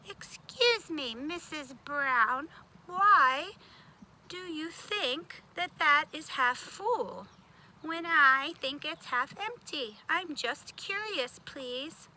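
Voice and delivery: slow, soft, polite question. Shown as a demonstration. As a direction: ask it gently and kindly. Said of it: "See, I'm doing better".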